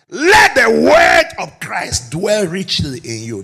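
loud, slow, weighty pulpit reading; slow, loud proclamation of the verse; proclaimed scripture. Acted. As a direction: lay each word down for the congregation.

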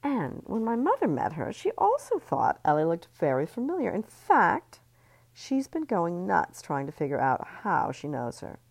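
gleeful sly sweetness; catty socialite. Acted. Missy voiced.

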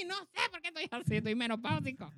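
high, whiny put-on voice; whiny menopausal voice. Joking about his mood to his cohost, in a whiny high put-on voice. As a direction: whine like you're going through menopause. Mock self, menopausal whine.

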